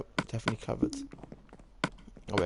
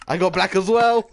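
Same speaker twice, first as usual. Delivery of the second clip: exasperated; 8 semitones higher, 9 dB louder than usual. Real, not acted. Frustrated at bad luck.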